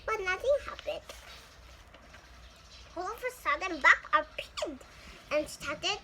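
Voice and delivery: ordinary delivery, nothing marked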